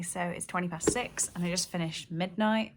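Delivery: ordinary delivery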